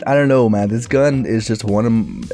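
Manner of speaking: ordinary talk, nothing marked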